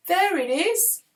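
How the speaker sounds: high, loud shout of discovery; yelling. A narrator's reading. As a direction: yell it out, pitched right up. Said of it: "Paz yelled".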